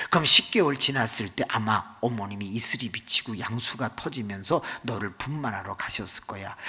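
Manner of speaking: ordinary talk, nothing marked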